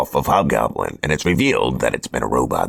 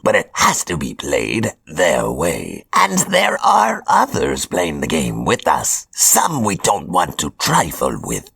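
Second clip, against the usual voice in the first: higher, theatrical villain voice; Hobgoblin voice; 5 semitones higher than usual. Acted. Hobgoblin announces his chosen apprentices, smug villain voice. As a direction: play a smug supervillain handing out orders.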